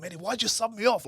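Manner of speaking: high-pitched, indignant complaint; retold outraged protest. Player protesting to his coach at being subbed, retold indignation. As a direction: act out losing it at the coach. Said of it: "I've lost emotional control".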